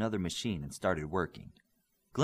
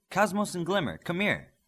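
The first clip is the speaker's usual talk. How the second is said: calling out; 8 semitones higher, 4 dB louder than usual. Acted. Noguchi calls them from behind.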